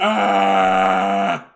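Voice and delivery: loud, low sustained "aah" scream; long scream. Acted. Frankie's audition speech turns out to be a scream, long yell. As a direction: hold one long yell without wavering. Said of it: "And then he just screams".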